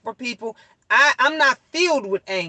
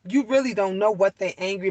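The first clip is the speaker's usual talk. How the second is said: normal talking voice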